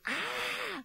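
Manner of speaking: demo scream. Shown as a demonstration. Therapist demonstrating venting anger, short high scream. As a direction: let the anger out in one cry. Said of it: "Sí, mínimo gritar".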